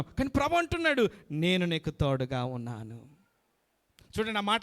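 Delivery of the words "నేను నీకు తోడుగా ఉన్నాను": slow, much lower, gentle voice of God; tender promise. Acted. Preacher voicing God's comfort to the congregation, slow and tender.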